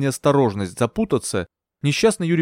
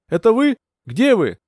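high, urgent searching call; 9 semitones higher, 4 dB louder than usual. A narrator's reading. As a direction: shout questions into the dark. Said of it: "кричала я".

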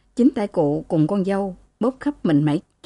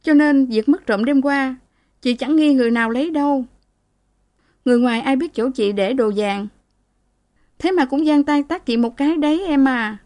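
higher-pitched voice of a woman confiding her troubles; aggrieved married sister; 6 semitones higher than usual. Acted. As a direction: pour out a grievance to your sister, pitched up. Married sister continues her complaint.

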